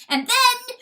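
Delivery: high, loud bellowed lead-up. A narrator's reading. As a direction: bellow it, holding the pitch high. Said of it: "bellowed the weasel".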